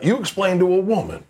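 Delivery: high-pitched southern grandfather; an incredulous grandfather's southern twang; southern accent. Acted. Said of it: "he has a southern accent".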